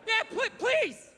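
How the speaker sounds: high, slow, desperate begging; pleading gorilla. Acted. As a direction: beg for rescue, stretching the words high. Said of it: "And the thing would be like".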